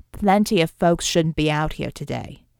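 low, firm comeback stressing "Plenty"; pointed retort. A narrator's reading. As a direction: throw the protest back, firmly. Corby retorts to Klaus's protest.

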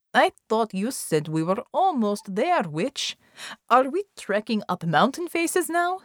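querulous questioning, rising at the end; complaining team member. Acted. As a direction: challenge the guide with a complaint. Narrator voices a complaining character.

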